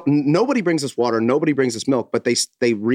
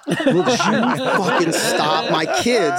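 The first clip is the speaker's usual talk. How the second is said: slow, laughing, higher plea to stop; laughing scold; 7 semitones higher, slower than usual. Retold or imitated. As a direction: scold him while laughing, slowly. Wife scolding mid-laugh in story.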